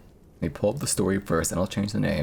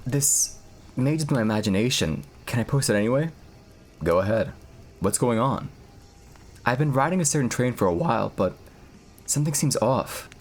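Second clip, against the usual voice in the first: lightly voiced forum posts, a bit higher and calmer; story reading; 4 semitones higher than usual. Streamer reads the 2chan train story to chat, in a hushed storytelling voice. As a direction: read the anonymous posts aloud like a creepy tale.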